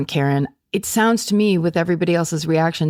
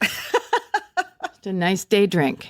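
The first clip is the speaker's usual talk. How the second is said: laughing; much slower than usual. Real, not acted. Laughing joke.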